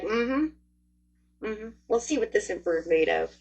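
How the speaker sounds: laid-back, wait-and-see hum; kicking back. Acted. Reader voicing Capricorn weighing up the emperor, kicking back. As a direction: hum along coolly, sizing them up. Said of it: "You're, like, kicking back".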